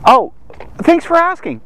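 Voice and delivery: normal talking voice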